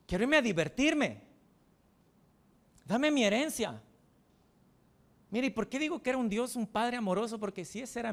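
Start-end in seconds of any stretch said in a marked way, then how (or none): 0.1-3.8 brash demand. Acted. Younger son demands inheritance.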